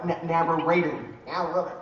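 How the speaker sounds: normal talking voice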